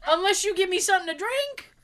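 laughing high protest. Retold or imitated. Protesting the urine test.

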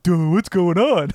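slow, dopey mock voice of a dum-dum; clueless dum-dum. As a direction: imitate a clueless guy, dragging the words. Imitates film character as clueless dum-dum.

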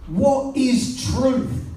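mock angry Pilate. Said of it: "he begins to get angry".

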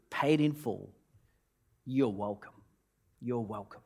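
hushed, tender reading of the note; gentle and soft. Acted. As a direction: let it fade to a soft whisper of grace. Preacher reads God's note softly.

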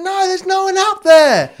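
high, slow mum voice; the mother, calmly puzzled, calling up; mum's reply. Retold or imitated. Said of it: "She went".

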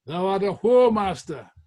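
loud, high accusing shout; shouted insult. Retold or imitated. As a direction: hurl the insult at him. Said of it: "Cromwell shouted to one".

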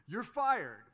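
loud mock boss firing; playful kid pretending to fire someone. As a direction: bark it like a little boss. Kid playing pastor firing kids.

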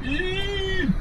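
thin, high-pitched wailing howl. A narrator's reading. Cornered suspect starts to shake, high howl. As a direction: howl on one long high note. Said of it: "as he began howling".

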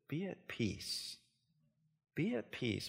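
hushed slow be at peace; gentle reassurance. Acted. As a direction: say it softly like a blessing. Preacher echoes Jesus' words.

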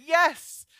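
acted, loud, high exclamation; excited agreement. The Spirit eagerly agreeing about the man, excited.

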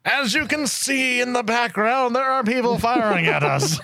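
high, slow war correspondent; field reporter voice. Retold or imitated. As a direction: report from the front line, drawn out. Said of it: "out on location in Africa right now is Anthony".

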